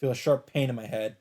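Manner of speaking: usual speaking voice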